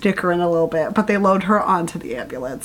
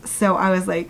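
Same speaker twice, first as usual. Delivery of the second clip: ordinary delivery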